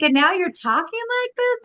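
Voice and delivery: high-pitched retold parental disbelief; mock dismay. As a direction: question your child in playful, high-pitched dismay. Retells confronting her son.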